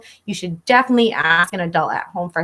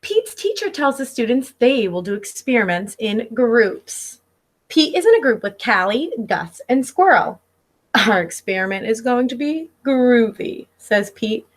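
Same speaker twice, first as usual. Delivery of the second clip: lively picture-book read-aloud; reading to children. Acted. Teacher reading Pete the Cat to her class, storybook voice. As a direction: read it like story time for little kids.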